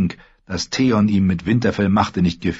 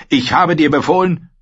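put-on higher, louder young lord ranting; raised, frustrated voice of an embattled lord; Theon, angry and anxious; 9 semitones higher, 5 dB louder than usual. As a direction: build up the grievance, then demand. Theon insists.

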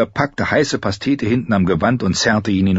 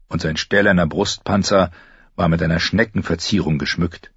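plain reading voice

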